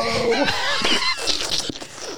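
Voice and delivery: high squealing laugh; shrieking laugh. Real, not acted. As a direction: let out a piercing cackle. Shrieks after own joke.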